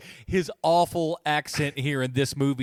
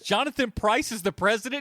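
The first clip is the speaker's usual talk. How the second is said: ordinary talk, nothing marked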